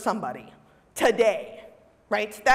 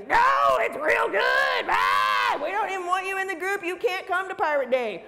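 hammed-up outrage, high-pitched; squeaky cartoon fury at the doubter; mock angry shout; 6 semitones higher than usual. Voicing angry pirate fan.

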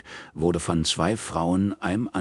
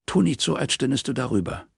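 teasing; 8 semitones higher, faster than usual. A narrator's reading. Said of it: "Du kokettierst doch nur".

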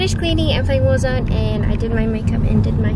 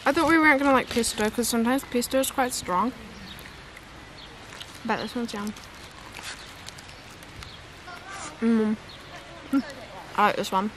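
speaking between bites of sandwich; talking while chewing; 13 dB quieter than usual. Real, not acted. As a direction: mouth partly full of food. Seen 0: She holds the sandwich, lips working between words after her bite.

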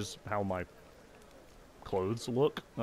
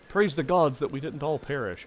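relieved survivor; 5 semitones higher, 5 dB louder than usual. Acted. Survivor gives thanks.

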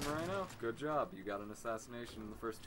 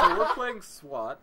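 laughing; 10 semitones higher, 14 dB louder, much slower than usual. Real, not acted. Still laughing from the joke.

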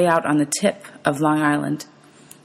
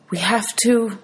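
higher, faltering voice; 6 semitones higher, slower than usual. A narrator's reading. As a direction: let the words falter, pitch raised. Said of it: "Her voice faltered".

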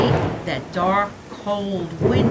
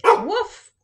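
high, louder woof; a high yappy dog woof; dog bark; 13 semitones higher, 5 dB louder than usual. A narrator's reading. Dog Fred barks.

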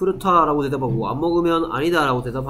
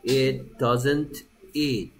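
very slow, words set apart; teacher's slow model sentence; slow demo; much slower than usual. Shown as a demonstration. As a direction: model it slowly for the class. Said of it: "slow model sentence for learners".

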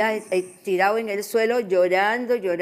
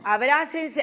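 imitating priest; 7 semitones higher, slower than usual. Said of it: "así hablaban".

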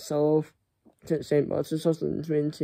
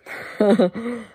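burst of laughter; 6 semitones higher, 5 dB louder than usual. Real, not acted. Loud laugh as pet leaves.

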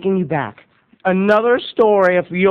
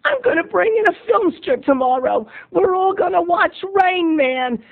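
much higher, gushing teacher; 11 semitones higher, slower than usual. Retold or imitated. Teacher thrilled to announce a movie to class, high gushing. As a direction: play the giddy teacher announcing a treat. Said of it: "Missus Hamden says".

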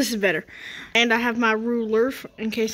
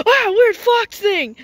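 slow, much higher and louder scream of fright; high scared cry; terrified scream; 10 semitones higher, 7 dB louder, slower than usual. Acted. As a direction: shriek at the fox. Attacked by the fox.

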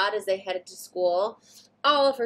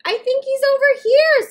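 much higher, louder call; 11 dB louder than usual. Acted. Satya calling to the boy about his puppy, loud. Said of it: "Super Satya called to the boy".

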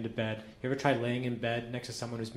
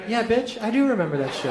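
higher, louder sarcastic snap; snapping back; 8 semitones higher, 6 dB louder than usual. Retold or imitated. Boyfriend to girlfriend, loud sarcastic retort. Imagined comeback to girlfriend years later.

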